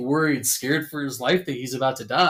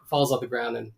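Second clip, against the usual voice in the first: ordinary delivery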